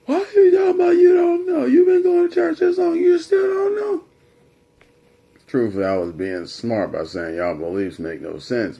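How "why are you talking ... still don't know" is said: very high, loud, fast mock outrage; incredulous yelling dad. Dad berating son after years of church, incredulous yelling. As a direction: rattle it off like Dad yelling in disbelief.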